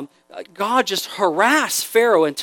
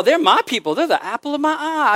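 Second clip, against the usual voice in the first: proud God; 7 semitones higher than usual. Retold or imitated. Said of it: "He likes to call".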